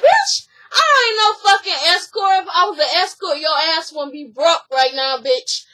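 high, loud yelling that settles at the end; imitated furious shout. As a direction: yell back hard, then sneer the last word. Acted quarrel, shouted denial.

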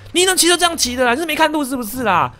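high, loud scolding, mock auntie yelling; imitating an angry auntie berating him; retold scolding. Imitating the auntie scolding the rider who hit her, high and loud. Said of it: "下车之后大骂哦".

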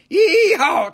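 high, loud mock cowboy holler; cowboy whoop. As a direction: let out a rodeo whoop.

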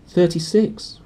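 put-on high whiny spoilt boy; whiny Dudley. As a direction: sulk over the count. Dudley counts presents.

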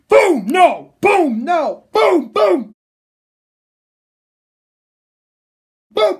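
very loud, high booming blows; shouted impact noises. Retold or imitated. Reviewer acting out the robot being pummelled, shouting. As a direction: shout each blow like an explosion.

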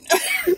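breathy high giggle. Real, not acted. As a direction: giggle between the pleas. Giggling between pleas.

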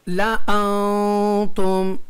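ला अंतुम very slow, long held nasal; recitation demo. Shown as a demonstration. Teacher shows students the reading, drawn-out ला अंतुम. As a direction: chant ला अंतुम, humming through the n. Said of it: "ज़ैद अलिफ को नहीं पढ़ेंगे".

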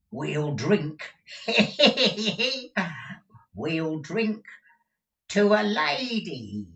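put-on high tittering old miser. Gride tittering his toast to Newman, high and giggly. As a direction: giggle it out like a sly old man. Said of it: "tittered Arthur".

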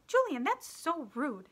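high, loud, drawn-out reproach; indignant scolding. A narrator's reading. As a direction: scold a classmate, appalled. Girl scolds rude classmate.

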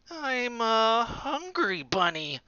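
high, slow menacing boast; slow high menacing child voice; menacing bunny. A narrator's reading. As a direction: threaten slowly and sweetly. Dot menaces the bear.

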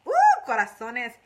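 loud, thrilled "Ooh" at the hearts; delighted. Real, not acted. To live viewers sending hearts, a loud delighted ooh. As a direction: burst out happily at the hearts.

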